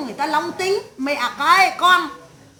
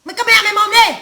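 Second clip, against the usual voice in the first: raised, louder rebuke; acted scolding; 4 semitones higher, 5 dB louder than usual. Mother telling her cursing son to shut up, scolding. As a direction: shut them up with a rising angry order. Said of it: "Thế mày mắng".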